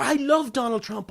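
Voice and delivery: high-pitched imitated fan outburst; mock screaming. Public reacting to headline, mock hysterical adoration. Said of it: "screaming and shouting".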